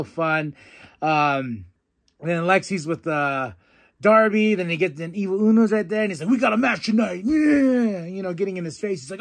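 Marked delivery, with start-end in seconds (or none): 6.3-8.0 hyped-up shout. Retold or imitated. Hyping quote of Evil Uno.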